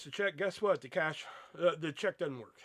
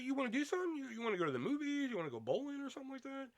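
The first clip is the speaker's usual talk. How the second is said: higher, quick and pushy sister voice; imitating sister; 10 semitones higher, 5 dB quieter, faster than usual. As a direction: rattle off offers like an eager sister. Sister voiced pushing plans.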